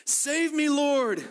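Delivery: high, desperate cry for help; shouted plea. Retold or imitated. Peter sinking, shouting to Jesus for rescue. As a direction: cry out like a drowning man. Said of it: "he shouted".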